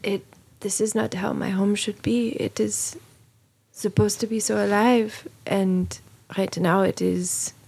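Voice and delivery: usual speaking voice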